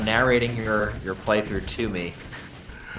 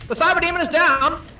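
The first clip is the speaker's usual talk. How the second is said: put-on old radio announcer; 5 dB louder than usual. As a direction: repeat the call like forties radio. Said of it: "an old-timey radio announcer in here to do this for us".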